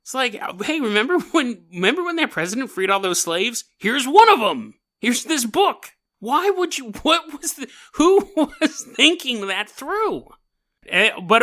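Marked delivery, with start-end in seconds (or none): 0.6-5.9 mocking voice. Mocking the Lincoln book giver. 6.2-10.3 high, laughing disbelief; incredulous. Real, not acted. Disbelief at the skin book.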